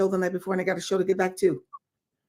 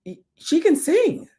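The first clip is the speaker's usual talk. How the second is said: higher, slow astonished praise; amazed panel; 6 semitones higher than usual. Retold or imitated. Casting panel amazed by her singing, higher and slow. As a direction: marvel at her voice. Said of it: "they were like".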